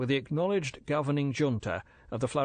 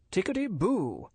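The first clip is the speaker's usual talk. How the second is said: higher, jaunty officer's phrase; Smythe's slang; 8 semitones higher than usual. Retold or imitated. Narrator quoting the major's slang, jaunty. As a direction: toss it off like a chipper British major. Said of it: "in Major Smythe's language".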